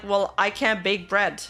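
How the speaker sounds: ordinary talk, nothing marked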